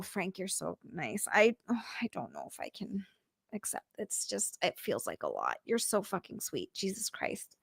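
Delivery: hushed, breathless disbelief; touched whisper. Real, not acted. Streamer to Frank, whispering in touched disbelief. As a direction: whisper it, overwhelmed by kindness.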